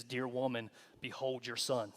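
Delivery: quietly tender; tender Jesus. Retold or imitated. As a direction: speak lovingly to a grieving mother. Jesus on the cross to mother.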